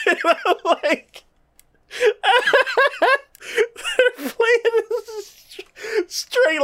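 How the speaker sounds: high, slow, breaking into laughter; laughing so hard the words stretch; helpless high laughter while describing it. Real, not acted. Laughing at show.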